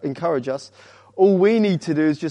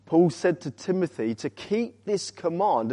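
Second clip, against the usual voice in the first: ordinary delivery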